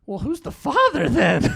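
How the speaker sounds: high, loud comic indignation; hammed-up alarmed outburst with a laugh; mock outrage. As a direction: demand it like a scandalized husband. Acts out alarmed husband's question.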